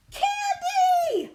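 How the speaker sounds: high, loud outraged cry; shocked shout. Acted. Reader revealing the hated food, shouting. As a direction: blurt it out in shock.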